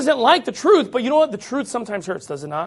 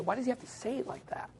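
soft, fast, laughing mimicry; mock complaint laughing; 19 dB quieter, faster than usual. Preacher mocking critics while laughing softly. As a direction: giggle through the critic's whine. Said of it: "it's just how he says it".

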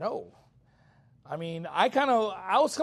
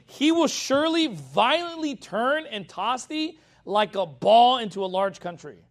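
slow, raised preaching read; scripture reading; 3 semitones higher, 5 dB louder, slower than usual. Acted. Preacher reading Isaiah to his church, slow and raised. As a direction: lay out the verse, deliberately.

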